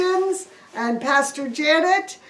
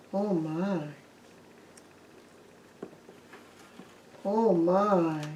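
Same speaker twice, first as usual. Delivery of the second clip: awed hush; 5 semitones lower, 10 dB quieter, slower than usual. Acted. Disciples awed by wounds.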